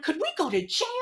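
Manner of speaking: high, loud panicked question; reenacted alarm, loud and high; retold frantic bawling. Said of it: "I just started bawling".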